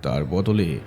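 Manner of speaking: plain reading voice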